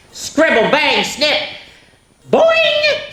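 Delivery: high, loud, bouncy sound effects; loud high cartoon sound words; playful sound words. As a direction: pop each noise out, boing way up high. Reading sound words with gusto.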